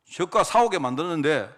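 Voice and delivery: usual speaking voice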